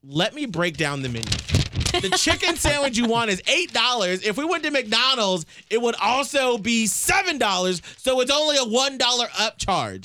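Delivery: high, patient explaining voice. Retold or imitated. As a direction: break it down like to a child. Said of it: "I was like".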